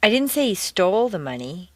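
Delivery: "stole" emphasized and lengthened; stress on STOLE. Shown as a demonstration. Teacher indicating he only borrowed it, stress on "stole". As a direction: punch "stole" to question that verb. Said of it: "indicate that he borrowed the money".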